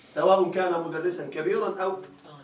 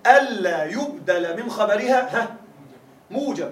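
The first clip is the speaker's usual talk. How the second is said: slow dictation; slower than usual. Shown as a demonstration. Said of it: "قال ابن عقيل".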